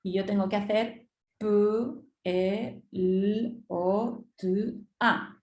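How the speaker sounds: each sound said separately, pause between; sounding out letters. Shown as a demonstration. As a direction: spell pelota out as P, E, L, O, T, A. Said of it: "segmentar la palabra fonéticamente". (sonidos separados uno a uno)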